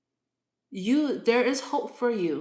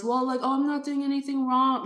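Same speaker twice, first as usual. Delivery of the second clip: mocking whiny quote; 7 semitones higher, 5 dB louder, faster than usual. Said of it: "you say all this".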